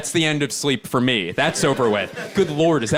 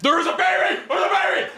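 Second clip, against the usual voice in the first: very high repeated scream. Retold or imitated. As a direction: imitate a night-terror scream, high-pitched. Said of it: "he is screaming over and over again".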